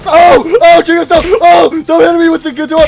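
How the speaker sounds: high loud yelps of pain; pained cries. Acted. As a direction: cry out as blows land. Being hit with guitar.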